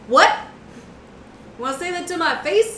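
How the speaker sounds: high, quick indignant comeback; put-on affronted challenge; mock challenge. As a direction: playfully pick a fight. GM voices offended character's challenge.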